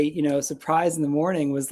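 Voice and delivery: usual speaking voice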